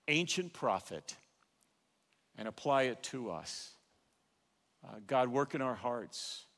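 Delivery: soft, hushed pastoral prayer; closing prayer. Acted. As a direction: pray quietly over the congregation, fading at the end.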